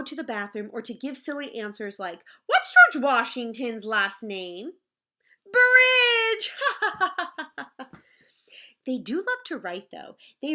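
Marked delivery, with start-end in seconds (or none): 2.5-6.5 louder, a gleeful yelled answer; silly kid shout. Acted. Teacher reading to her class, silly kid shout. 6.6-7.9 laugh. Real, not acted. Laugh after joke.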